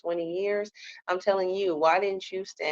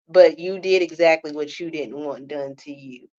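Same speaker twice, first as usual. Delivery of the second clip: ordinary talk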